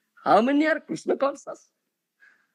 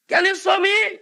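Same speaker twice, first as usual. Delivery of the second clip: higher, pointed challenge; skeptic challenge; 7 semitones higher than usual. Retold or imitated. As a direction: dare him to show it. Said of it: "the next question you'll ask".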